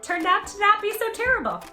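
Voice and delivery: ordinary delivery, nothing marked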